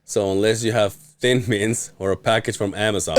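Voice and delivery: normal talking voice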